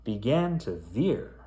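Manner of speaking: spooky rhyming storybook read; story narration. A narrator's reading. Back to reading the creepy rhyme to kids, storyteller voice. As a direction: pick the tale back up, storyteller voice.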